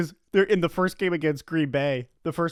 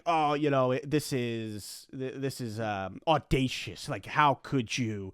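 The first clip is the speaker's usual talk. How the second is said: slow, softer scandalized tut; mock prim critic; 3 semitones lower, 4 dB quieter, slower than usual. Mocking critics of fourth-down calls, slow and scandalized. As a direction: clutch pearls, slow and hushed. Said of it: "some people look at it".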